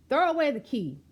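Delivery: loud, high jeering call; a hammed-up punitive chant; mock harsh demand. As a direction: bark it like a tough-on-crime crowd. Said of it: "they say".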